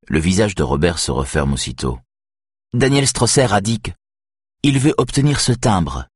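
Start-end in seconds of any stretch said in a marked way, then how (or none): 2.7-6.1 a lightly voiced, dry, higher reply. A narrator's reading. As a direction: say it dryly, on the defensive. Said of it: "explique-t-il sèchement". (réplique sèche, voix plus aiguë)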